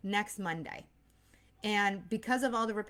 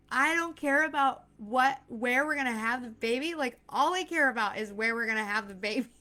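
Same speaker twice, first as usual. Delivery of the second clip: louder and higher, arguing back; wife arguing back at husband, louder and higher; heated retort; 4 semitones higher, 5 dB louder than usual. Retold or imitated. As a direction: snap back in exasperation. Retold argument with husband.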